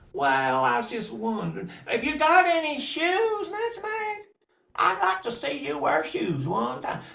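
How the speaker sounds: very high, laughing taunt; mocking cousin. Cousin laughing at Mutzmag's bare feet, very high. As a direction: tease her with a snicker.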